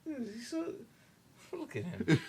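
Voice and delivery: high, cooing over something cute. Real, not acted. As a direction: gush like it's a puppy.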